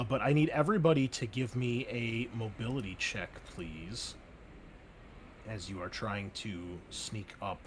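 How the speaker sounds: normal talking voice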